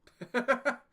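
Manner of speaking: loud, high laugh; burst of laughter. Real, not acted. Player whose plan didn't work out, loud burst of laughter. Reacts to a failed move.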